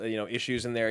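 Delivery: ordinary delivery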